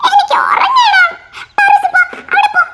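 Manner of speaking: annoyed yell. Acted. Tired child yells at sibling.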